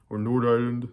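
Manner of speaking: mock Northern Irish accent on Nord Ireland, said as "Nord"; brief teasing Northern Irish accent. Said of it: "however you say it in the accent".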